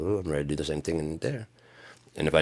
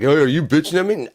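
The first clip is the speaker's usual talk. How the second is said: mock indignant; 11 semitones higher, 9 dB louder than usual. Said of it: "come across like".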